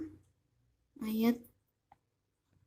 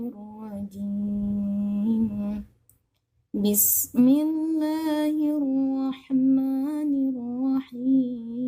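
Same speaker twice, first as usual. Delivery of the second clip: Quran recitation; 2 semitones higher than usual. Acted. Recites Ayat al-Kursi.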